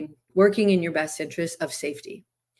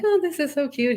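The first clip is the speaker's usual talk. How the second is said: cooing delight; 9 semitones higher than usual. Retold or imitated. Cooing over baby chicks.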